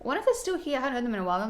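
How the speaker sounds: ordinary talk, nothing marked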